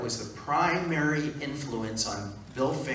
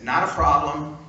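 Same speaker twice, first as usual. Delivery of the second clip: louder, upbeat, sure of himself; confident; 3 semitones higher, 7 dB louder than usual. Retold or imitated. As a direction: toss it off confidently. Confident reply to deadline.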